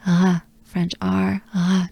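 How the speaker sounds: "re" as just a breath in the throat; breathy French R. Shown as a demonstration. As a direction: exhale the French R in "re". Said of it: "just a breath".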